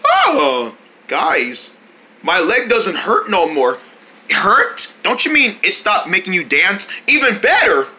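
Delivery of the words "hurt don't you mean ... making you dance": ordinary delivery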